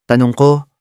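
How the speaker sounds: ordinary delivery, nothing marked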